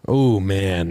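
ordinary talk, nothing marked

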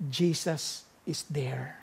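slow, soft reverent; tender slow. Acted. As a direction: let it land gently and slowly. Climactic sermon point. (banayad at mabagal na pagpapahayag)

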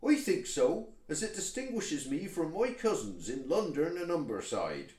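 broad West Country toad; West of England accent. Acted. Toad tells of his cousins elsewhere, West Country accent. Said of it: "somewhere vaguely in the west of England".

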